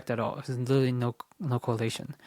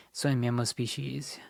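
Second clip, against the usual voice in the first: whisper; 4 dB quieter than usual. Real, not acted. Reading text under breath.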